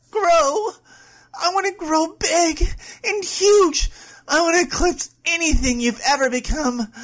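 high, loud, forceful demands; frenzied scream. Acted. Woman declaring her ambition to her girlfriend, screaming wildly. Character demands to grow, overwhelmed.

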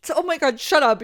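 high, loud giddy shock; overjoyed disbelief. Acted. Friend reacting to a confession, giddy loud disbelief. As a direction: blurt it out, thrilled and stunned.

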